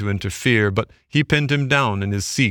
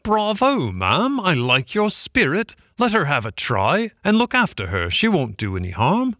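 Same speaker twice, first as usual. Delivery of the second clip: hearty gentleman; 7 semitones higher than usual. A narrator's reading. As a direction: cheer her on from high up. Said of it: "he said to Toad's delight".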